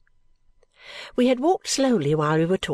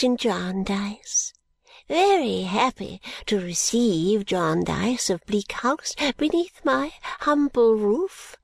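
put-on high, slow, fussy old lady greeting guests; an eccentric old woman, honoured and gracious; fluttery old lady; 4 semitones higher, slower than usual. Old Miss Flite welcomes the wards, high and slow.

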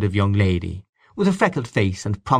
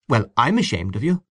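frank; 3 semitones higher than usual. A narrator's reading. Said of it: "said Miss Ivors frankly".

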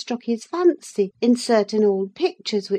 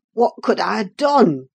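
lower-voiced man protesting his innocence; aggrieved young man; 3 semitones lower than usual. A narrator's reading. Cousin answers the woman's reproach, aggrieved. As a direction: plead your case in a man's voice. Cousin defends himself against rebuke.

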